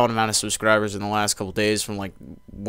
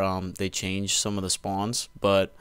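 usual speaking voice

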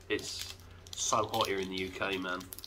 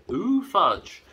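high, louder swooping delight; drawn out delight; 10 semitones higher, 5 dB louder than usual. Shown as a demonstration. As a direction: swoop down on ooh with relish. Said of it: "There you go, that's all it is".

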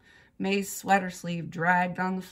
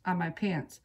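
plain reading voice

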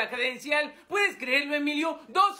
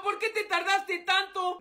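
ordinary talk

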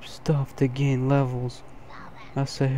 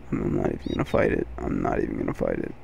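deep voice; faster than usual. Acted. Deep joke voice before the real one.

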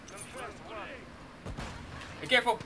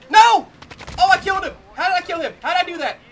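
loud, high cry easing into disbelief; astonished outburst; 4 semitones higher, 8 dB louder, much faster than usual. Real, not acted. Player can't believe he killed him, astonished outburst. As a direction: exclaim in real amazement at your own luck. Shock at an unexpected kill.

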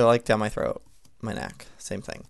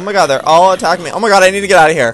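panicked; 5 semitones higher, 14 dB louder, much faster than usual. Real, not acted. Swarmed by zombies in game.

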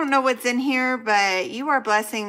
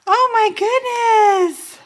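high, loud, slow exclamation of delight; thrilled amazement; 12 semitones higher, 7 dB louder, slower than usual. Real, not acted. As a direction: draw it out, thrilled by the gift. Overwhelmed by gift items.

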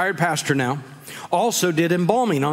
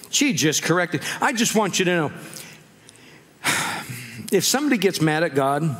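ordinary delivery

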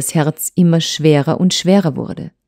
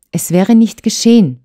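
higher, emphatic reproach; reproachful insistence; 6 semitones higher, 4 dB louder than usual. A narrator's reading. Wife answers his puzzled question, reproachful. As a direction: answer back with hurt insistence. Wife's acted reproach in quarrel.

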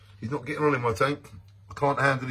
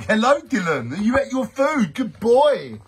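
high, louder pet-talk voice; talking to the dog; 9 semitones higher, 6 dB louder, slower than usual. Real, not acted. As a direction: coo at the French bulldog. Greeting the dog.

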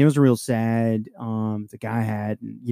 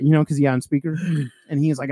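ordinary talk

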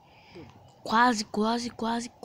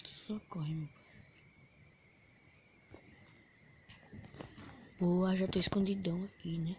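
whisper; 4 semitones lower, 8 dB quieter than usual. Real, not acted. Hiding in game, whispering.

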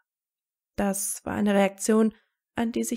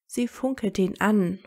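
usual speaking voice

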